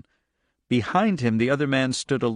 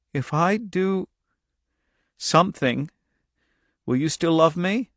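put-on high, halting little-girl voice; hesitant child; 6 semitones higher than usual. Girl asking if her father still loves her, hesitant. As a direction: ask haltingly, pitched up, with long pauses.

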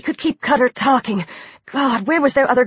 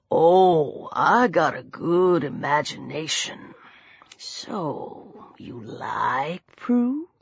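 deep, hushed, leering drawl; soft, slow, taunting menace; lazy drawl; 7 semitones lower, 7 dB quieter, much slower than usual. A narrator's reading. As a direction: stretch it out low and lazy. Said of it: "He drawled the word, his voice lazy".

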